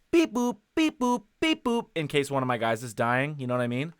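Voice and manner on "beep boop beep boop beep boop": high-pitched electronic beep-boop imitation; sing-song mock game beeps; imitated game alarm. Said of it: "so that I can hear that".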